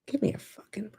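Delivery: whispered, fed-up aside; hiss-free soft whisper of disbelief; exasperated whisper. Retold or imitated. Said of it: "But now I'm just like".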